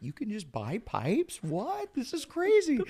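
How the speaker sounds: high, hushed marvelling; naive amazement. Retold or imitated. Newcomer in a Hollywood head shop, amazed.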